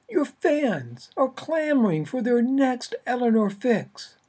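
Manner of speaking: high, loud pushy agent on the phone. Acted. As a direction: push hard like an impatient agent. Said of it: "only a few decibels softer".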